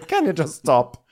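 lightly acted fed-up mother; mock annoyance. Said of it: "gets annoyed and goes".

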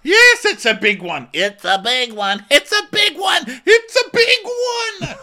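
hammed-up repetition, climbing to a squeal; mock repeating cast. Cast members repeating themselves, building mock squeal. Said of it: "They just keep repeating it".

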